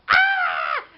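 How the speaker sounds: high, loud panicked scream. Acted. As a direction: let out a full scream of terror. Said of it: "I screamed".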